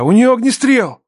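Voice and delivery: high, loud, urgent shout; crying out. A narrator's reading. Man alerts medics to the wound, urgent shout. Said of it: "крикнул я".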